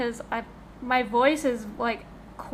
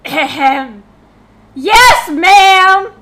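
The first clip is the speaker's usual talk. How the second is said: much higher and louder, like a soldier answering; shouted yes ma'am; 6 semitones higher, 16 dB louder, much slower than usual. Retold or imitated. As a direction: bark it out to a strict mom, drawn out. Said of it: "And I'm like".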